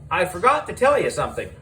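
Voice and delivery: plain reading voice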